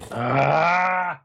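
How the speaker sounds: loud, high "ah"; drawn-out cry. Acted. Player reacts as the leader turns away, drawn-out cry. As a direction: let out a long cry.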